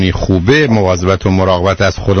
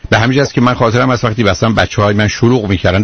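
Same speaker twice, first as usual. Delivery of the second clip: normal talking voice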